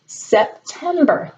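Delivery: September said slowly, each syllable separate; Sep, tem, ber, one clap per beat; clapped syllables. Shown as a demonstration. Teacher leading a clapping game, slow syllables. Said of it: "clap your hands for each part of that word".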